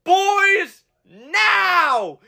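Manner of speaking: high yelled command to the crew; shouted order. Retold or imitated. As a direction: bark it like a fire captain. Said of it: "they go".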